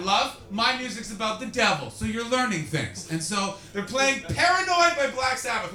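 normal talking voice

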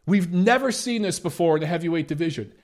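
ordinary talk

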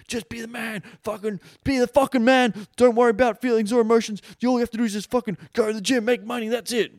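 hammed-up alpha rant; mocking overbearing alpha. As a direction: yell it like a cartoon meathead. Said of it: "overbearing fucking alpha where it's like".

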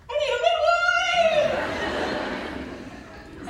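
very high screamed call for help; panicked mock-calm shriek. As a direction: comically shriek it down the hallway. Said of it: "in the calmest voice I can muster".

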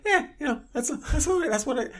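high, laughing happy wife voice; a giggly, high chirp; happy matter of fact. Retold or imitated. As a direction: squeak it out, cheerfully unbothered. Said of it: "She's like".

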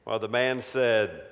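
ordinary delivery

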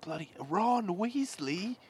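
slow, high mock-British greeting; put-on British accent. Student spots a schoolmate, put-on British accent. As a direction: draw it out in playful British. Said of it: "And we'll be British".

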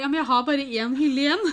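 high, loud stunned protest; shocked blurt. Retold or imitated. Surprised bride protesting to her boss, loud and high.

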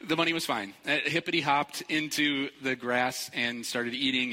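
usual speaking voice

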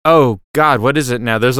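usual speaking voice

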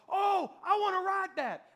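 high, loud burst of eagerness; eager excited kid. Retold or imitated. Friend spots the ride at the park entrance, eager and excited. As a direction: blurt it out like a thrilled kid.

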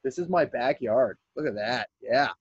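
ordinary talk, nothing marked

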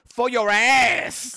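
drawn-out, high, loud bellow of the punchline; slow hammed-up shout; mock yell. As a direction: belt it out slowly like a joke. Mock-shouted pickup line.

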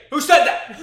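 high, very loud hammed-up outrage; mock enraged shout. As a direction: explode at an unseen heckler, hammed up. Acts out enraged singer again.

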